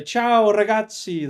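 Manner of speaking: loud, high, slow Italian "Ciao, ragazzi"; Italian greeting. Shown as a demonstration. Greeting the Italian class on arrival, loud and drawn out. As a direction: call it out long and bright like walking into class. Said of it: "If you just come in and say".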